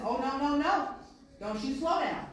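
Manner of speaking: loud, high, urgent shouting; yelling coach. Retold or imitated. Coach yells at crawling player, loud and urgent. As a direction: drive him on at full volume.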